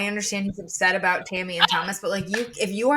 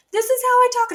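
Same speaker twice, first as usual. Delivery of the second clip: much higher and louder, a brash diva declaration; over-the-top high diva voice; Michelle Visage impression; 8 dB louder than usual. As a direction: belt it out like a campy Drag Race judge. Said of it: "like the fucking Michelle Visage from Drag Race".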